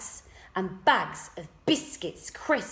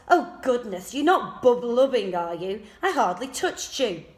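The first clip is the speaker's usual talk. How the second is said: mocking; 4 semitones higher, 7 dB louder than usual. A narrator's reading. Giles mocks crying boy.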